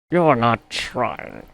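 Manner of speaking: stern crew scolding a sulky engine; severe scolding. A narrator's reading. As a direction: scold each word slowly. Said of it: "talking to him severely".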